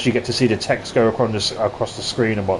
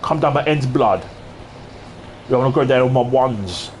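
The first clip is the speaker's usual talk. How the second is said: mock London slang. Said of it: "Instead of me saying things like".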